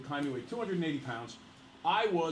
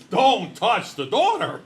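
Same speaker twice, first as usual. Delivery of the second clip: loud, slow, raised-pitch warning; mock protective warning; 8 semitones higher, 14 dB louder, much slower than usual. Dad guarding his daughter, loud mock-menacing warning. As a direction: belt out a hammed-up fatherly threat.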